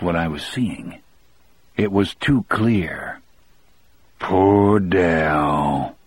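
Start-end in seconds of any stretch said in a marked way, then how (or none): 4.2-6.0 slightly lowered, husky mourning; low, hoarse voice. A narrator's reading. Weeping prisoner grieving for Dell, low hoarse voice. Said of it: "he said in a low, hoarse voice".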